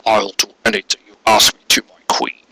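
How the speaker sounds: bow and thank her quietly. Acted. Mercury thanking her queen, low soft devoted voice.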